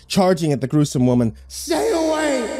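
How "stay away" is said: high, slow eerie shriek; witch scream. Acted. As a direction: drag out a ghoulish screech. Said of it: "she screamed".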